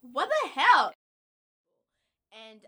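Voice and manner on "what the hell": loud, high disbelief; a sharp incredulous outburst; what the hell. Retold or imitated. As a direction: throw it out in outraged disbelief. Indignant reaction to accent mockery.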